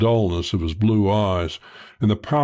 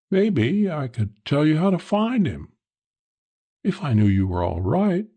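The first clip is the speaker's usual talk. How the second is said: higher, lightly coaxing woman's voice; a hint of the coy woman; lightly voiced coy woman; 7 semitones higher than usual. Woman sizing up a stranger, lightly coy.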